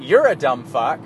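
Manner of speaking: normal talking voice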